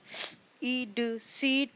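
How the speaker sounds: each letter sound said apart. Shown as a demonstration. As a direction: split the word into its separate sounds.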